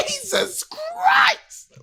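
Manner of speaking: high, drawn-out shriek; shrieked Jesus Christ. Retold or imitated. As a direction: cry it out high and long like a shocked scream. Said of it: "I was saying".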